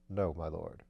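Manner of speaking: a composed valet answering his master; Bunter the valet. Acted. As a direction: impassive butler, low and quiet.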